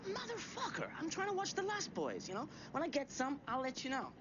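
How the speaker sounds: high, exasperated snapping; exasperated rant. Retold or imitated. Annoyed at phone callers.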